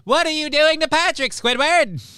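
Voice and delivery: very high cartoon outcry; SpongeBob. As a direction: confront them in a squeaky cartoon voice. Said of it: "slipping into a SpongeBob voice".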